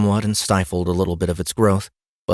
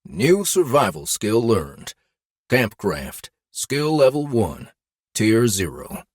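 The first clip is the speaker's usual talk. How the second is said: game notification voice; 4 semitones higher, slower than usual. Acted. Game system skill notification.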